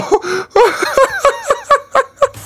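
long, high-pitched laughing fit; high cackling laugh. Real, not acted. Host joking about the police, cracking up.

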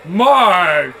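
loud startled cry; loud exclamation. Acted. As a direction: blurt it out in shock. Reacting to side effects.

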